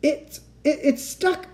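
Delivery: high, stammering moan; high-pitched helpless whine; distressed moan. A narrator's reading. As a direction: moan it in rising panic. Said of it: "I moaned".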